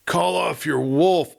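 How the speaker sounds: drawn-out plea with a hint of a sob; moaning in terror. A narrator's reading. Cowering sheriff begs Dan to call off the wolf, slow and terrified. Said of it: "moaned Morris, a sob of terror in his voice".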